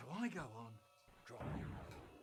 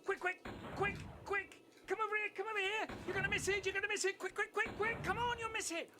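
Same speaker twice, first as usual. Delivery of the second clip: high, hurried squeal to hurry someone over; a big brother faking breathless urgency, pitched way up; excited brother calling out, very high; urgent excited brother; 4 dB louder than usual. Retold or imitated. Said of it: "suddenly he goes".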